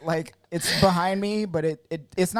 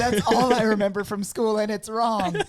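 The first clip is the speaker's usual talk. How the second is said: high, louder mock outrage; 7 semitones higher, 4 dB louder than usual. Real, not acted. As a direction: cry out in playful disbelief. Mock dismay at science.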